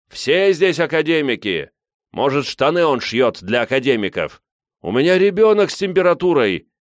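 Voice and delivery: outraged voices from a crowd; indignant crowd protest. A narrator's reading. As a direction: protest loudly over the crowd.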